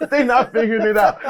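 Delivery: normal talking voice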